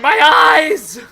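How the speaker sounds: high, loud cry of agony; pained scream. Acted. Merchant blinded by flash of brilliant light, screaming in pain. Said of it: "And just starts screaming".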